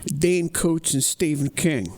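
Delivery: ordinary delivery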